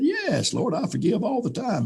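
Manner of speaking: high, eager insistence; eager protest. Retold or imitated. Eager protest answering God.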